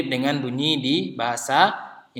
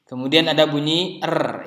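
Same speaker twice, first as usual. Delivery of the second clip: ordinary talk, nothing marked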